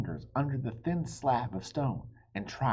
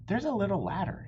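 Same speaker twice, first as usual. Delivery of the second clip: excited discovery; 11 semitones higher, 5 dB louder, faster than usual. Acted. Children exploring dark castle.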